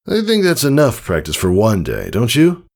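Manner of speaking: lightly voiced father, a touch of forced cheer; chuckling father. A hint of father joking to daughter after the strike.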